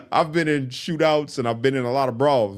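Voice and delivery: laughing while admitting fear; nervous real laughter. Real, not acted. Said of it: "I was fucking terrified".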